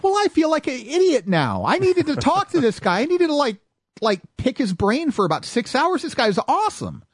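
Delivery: high, eager self-mockery; kicking yourself, then gushing; retold starstruck rush; excited realization. Realizes who the DJ was.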